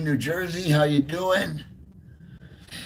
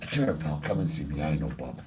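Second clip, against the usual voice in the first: much lower and softer, cooing to the dog; tender pet talk; 12 semitones lower, 12 dB quieter than usual. Real, not acted. To his dog after its nap, softly cooing. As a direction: soothe the sleepy pup gently.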